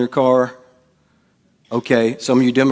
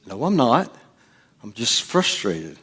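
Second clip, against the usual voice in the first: hushed, restrained insistence; quiet mock-calm denial; denying anger; 2 semitones lower, 5 dB quieter than usual. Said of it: "No, you're getting angry".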